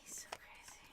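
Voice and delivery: soft breathy whisper. Real, not acted. Telling viewers about the cat, whispered. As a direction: whisper it so the cat won't hear. Aside while cat present.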